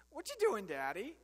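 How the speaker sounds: put-on a toddler asking again, insistently; toddler son repeating. Son repeats same question.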